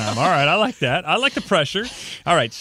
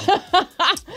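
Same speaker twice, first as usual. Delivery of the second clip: burst of laughter; 4 dB louder than usual. Real, not acted. Host cracks up at joke.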